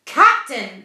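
loud, sharp reprimand. A narrator's reading. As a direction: cut in firmly to stop him. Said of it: "the first officer said sharply".

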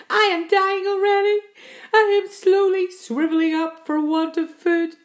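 high, loud doom-laden lament; melodramatic despair. A narrator's reading. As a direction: wail of impending death, hammed-up. Earthworm foretells slow starvation.

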